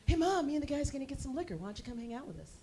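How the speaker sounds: lightly voiced casual son; son inviting mom. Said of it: "he keeps wanting to invite me".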